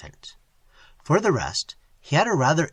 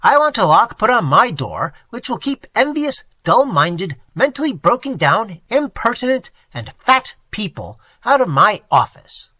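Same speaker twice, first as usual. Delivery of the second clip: loud, higher string of insults; insulting outburst; 7 semitones higher, 7 dB louder than usual. A narrator's reading. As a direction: pile the insults on. Quint insults Boomley loudly.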